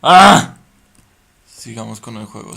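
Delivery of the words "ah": high, loud terrified scream; scream of fright. Real, not acted. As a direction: scream like something grabbed you. Jump scare in horror game.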